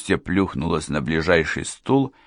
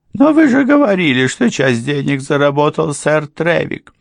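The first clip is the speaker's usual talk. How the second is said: much higher, louder objection; housekeeper's objection; 10 semitones higher, 7 dB louder than usual. A narrator's reading. Housekeeper objecting to the lawyer, high and loud. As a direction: catch him on his own words. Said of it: "housekeeper objects".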